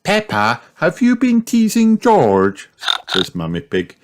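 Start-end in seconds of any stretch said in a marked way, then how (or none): 0.0-2.7 high-pitched questioning mum voice; scolding mother. A narrator's reading. Mummy questioning Peppa about teasing, scolding.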